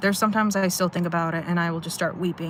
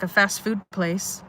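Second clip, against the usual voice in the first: normal talking voice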